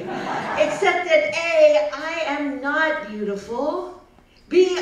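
ordinary delivery

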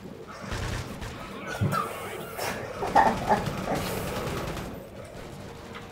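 high breathless giggle; squeaky laugh. Real, not acted. Gamer laughing at his own cursing, squeaky giggle.

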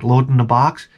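usual speaking voice

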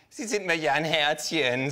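mocking. Kangaroo mocking clerk.

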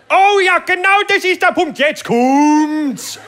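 very high, loud hammed-up yelling; man yelling in pleasure. Palatinate man yells from the massage cabin, very high and loud. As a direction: holler it from the massage booth, over the top. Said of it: "hörst du den Pfälzer noch einmal".

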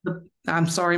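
ordinary talk, nothing marked

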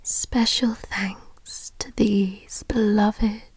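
unvoiced close-mic whisper throughout; ASMR whisper. Lover saying goodbye to partner, whispered ASMR. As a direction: breathe every word into the mic as a whisper.

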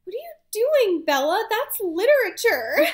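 high, loud mock outrage, stretched out on literature; scandalized Edward. Edward watching Bella throw the book in the fire, mock scandalized shriek. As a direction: gasp at her like she burned a masterpiece. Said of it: "and so Edward's like".

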